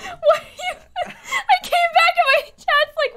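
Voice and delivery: high, squealing laughing speech; helpless laughter. Real, not acted. Streamer tells friend how chat reacted to the whispering, laughing hard. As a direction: try to explain while cracking up.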